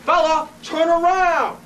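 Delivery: slow, loud, high order; forceful, drawn-out shout; yelled command. Acted. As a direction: shout it again, stretching each word. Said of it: "I yelled up to him".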